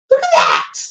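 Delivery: high burst of delight; excited squeal. Real, not acted. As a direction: squeal at the new toy. Excited at the toy.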